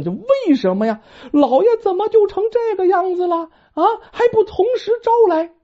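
高而急的女人腔质问; 又急又慌地逼问; 惊慌的地主婆. Acted. 老婆惊慌质问张三. (地主婆惊慌逼问张三)

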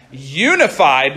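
Unified said slowly with a clear YOU at the start; corrected word Unified. Shown as a demonstration. Youth pastor correcting a misreading, Unified slow with "YOO" first. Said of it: "not unified".

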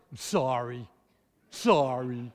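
low, mumbled sorry forced out; sulky kid barely saying sorry; child forced to apologise mumbles; grudging child apology. Retold or imitated. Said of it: "It barely comes out of his mouth".

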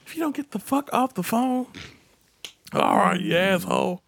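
high mock annoyance; comic high-voiced scolding; exasperated brush-off. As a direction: retell a fed-up dismissal on the phone. Retold brush-off on phone.

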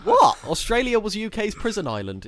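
high, loud indignant yelp; mock outrage. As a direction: fake shock to wind up the others. Mock outrage at Australia joke.

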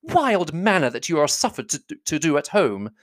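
high-pitched motherly reproof; high, fussy reprimand; scolding mother. A narrator's reading. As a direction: scold an unruly daughter. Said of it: "cried her mother".

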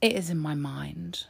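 ordinary delivery

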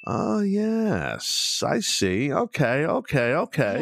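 high, drawn-out "oh yes" of realization; dawning realization. Retold or imitated. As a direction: act out slowly catching on to the idea. Acting out warming to friend's idea.

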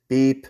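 whistle beep. Shown as a demonstration. Trainer imitating the whistle after sit, a sharp vocal beep. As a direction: mimic the whistle blast. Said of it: "beep with the whistle".

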